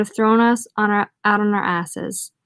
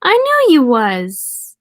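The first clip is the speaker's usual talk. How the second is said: slow, high, loud exclamation; excited drunk lumberjack; 5 semitones higher, 6 dB louder, much slower than usual. Acted. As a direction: draw out the delighted recognition, high and loud. Drunk French lumberjack's delighted recognition.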